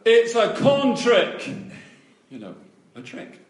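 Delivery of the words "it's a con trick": high, loud, drawn-out heckle; shouted accusation. Retold or imitated. Little boy calling out in class, imitated loud shout. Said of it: "he shouted out".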